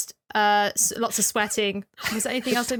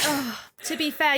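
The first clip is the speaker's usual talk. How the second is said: normal talking voice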